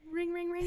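high sing-song ring, ring, ring; phone ring imitation.

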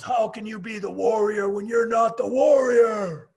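loud, slow, indignant bellow; Warrior's indignant shout. Retold or imitated. The Warrior yelling at Lenny in the office, slow furious shout. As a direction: boom it out like an outraged wrestler. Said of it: "Warrior goes".

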